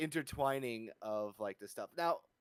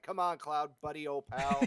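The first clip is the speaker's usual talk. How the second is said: chummy buddy voice, higher and louder; overly familiar; 4 semitones higher, 6 dB louder than usual. Retold or imitated. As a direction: play the overly friendly pal. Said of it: "just going like".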